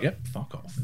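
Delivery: hushed, voiceless mock dismissal; imitating co-host. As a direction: imitate him brushing it off under his breath. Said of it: "You just went completely just like".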